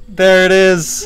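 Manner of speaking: slow, high, loud reveal shout; excited yelling. Real, not acted. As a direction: yell it out as a grand reveal. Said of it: "Oh god, I'm yelling too much".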